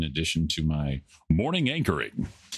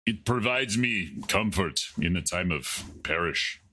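hammed-up, badly faked Irish accent; terrible Irish accent. Answering what he is drinking, terrible Irish accent. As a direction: put on a bad Irish brogue. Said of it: "and it's terrible Irish accent".

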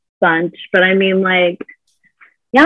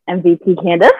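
ordinary delivery

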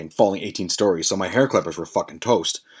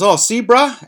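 much higher, louder mock-reassuring voice; imitated reassurance; 13 semitones higher, 5 dB louder than usual. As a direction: play a friend breezily showing it off. Imitates Tone showing off the jacket.

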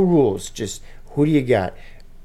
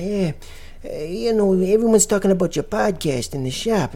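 higher put-on old-man voice, asking; [name]; 6 semitones higher than usual. [name] asks Ben for a chat, high put-on voice. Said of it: "[name] speaking to Ben".

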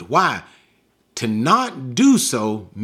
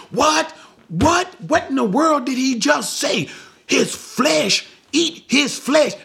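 high, incredulous "What? What?" of shocked listeners; 11 semitones higher than usual. Acted. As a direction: throw up your voice in disbelief, high and sharp.

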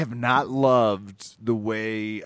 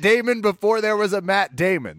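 higher, louder outburst of fan devotion; impassioned defence; 7 semitones higher, 4 dB louder than usual. Real, not acted. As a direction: champion the actor with raised voice and pitch. Mock-passionate defence of Van Der Beek.

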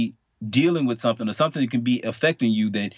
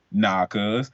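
ordinary talk, nothing marked

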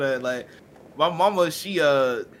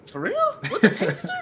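disbelief. Retold or imitated. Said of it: "I'm like".

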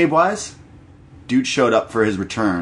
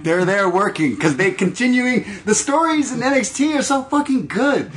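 excited rant; 11 semitones higher than usual. Real, not acted. Ranting about NXT stories.

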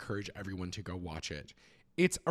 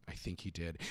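devilish Riku; 7 semitones lower, 7 dB quieter than usual. Acted. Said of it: "smiles devilishly and goes".

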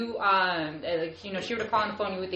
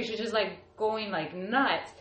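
ordinary delivery

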